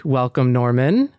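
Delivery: normal talking voice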